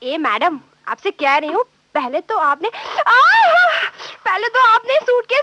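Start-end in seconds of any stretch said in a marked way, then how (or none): 3.1-3.9 pained cry. Acted. Suitcase dropped on foot.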